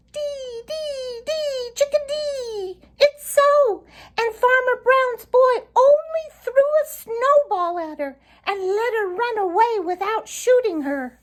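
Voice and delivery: very high, tiny birdlike voice; Tommy Tit chickadee. A narrator's reading. Tommy Tit the Chickadee backs up Sammy, very high and chirpy. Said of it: "declared a new voice".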